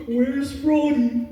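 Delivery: slow, dopey giant cat; lumbering gentle giant drawl; Big the Cat voice. Shown as a demonstration. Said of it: "get a joke out of my brother".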